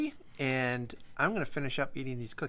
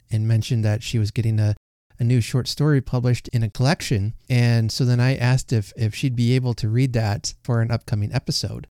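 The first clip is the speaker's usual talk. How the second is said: podcast host intro; 12 dB louder than usual. Acted. Formal story introduction.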